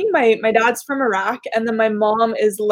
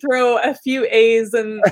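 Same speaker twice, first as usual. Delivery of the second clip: ordinary talk, nothing marked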